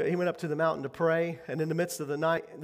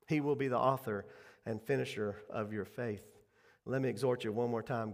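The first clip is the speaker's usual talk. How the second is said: ordinary talk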